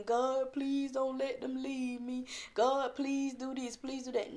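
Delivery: imitating someone pestering God for things; mock begging. Shown as a demonstration. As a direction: beg pitifully, as the wrong way to pray. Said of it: "Don't go in there begging".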